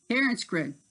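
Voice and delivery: ordinary delivery, nothing marked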